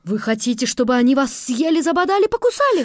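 high, loud teasing questions; teacher rallying. A narrator's reading. Teacher asking children about the zoo, high excited. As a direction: whip the kids into a frenzy.

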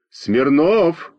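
lightly acted reprimanding cry; mild scolding shout. A narrator's reading. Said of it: "крикнула на Веньку Зинка Фокина".

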